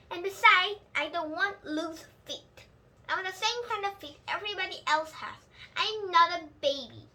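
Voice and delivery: put-on a stubborn kid who won't be called a baby; Junie B's voice. Child insists to mother.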